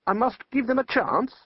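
lightly voiced wizard. Wizard questions Death.

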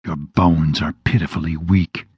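low, hissing demon voice. A narrator's reading. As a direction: hiss it out, low and menacing. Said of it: "he hissed".

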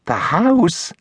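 high, incredulous woman's voice; assistant's high, surprised query; startled echo. A narrator's reading. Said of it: "she echoes".

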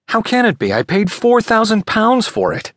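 high-pitched, incredulous protest; aghast buyer, pitched right up; shocked disbelief. Acted. Buyer told his purchase is fake.

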